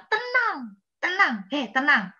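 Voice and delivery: lightly voiced command to calm down; God saying calm down. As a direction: call for calm, starting high and easing off. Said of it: "Jadi Tuhan bilang".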